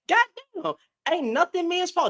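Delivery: high-pitched incredulous protest; mock exasperation. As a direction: act out a baffled complaint, voice high. Mock outraged quote within satirical blame bit.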